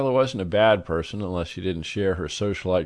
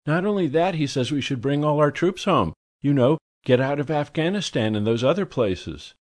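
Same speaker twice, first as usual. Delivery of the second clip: high, gushy society lady; effusive high society lady; gushing socialite; 6 semitones higher than usual. A narrator's reading. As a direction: swoon over the marvelous man.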